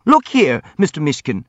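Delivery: high, loud squeak, dropping off; squeaky. A narrator's reading. Hippolyte rounds on the prince, squeaking. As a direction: squeak out to grab his attention. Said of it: "squeaked Hippolyte".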